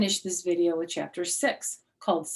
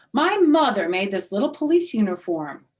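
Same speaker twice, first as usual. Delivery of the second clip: proud show-off; 8 semitones higher, 9 dB louder than usual. Acted. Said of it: "she said".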